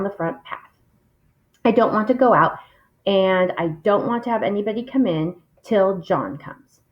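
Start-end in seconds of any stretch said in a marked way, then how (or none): none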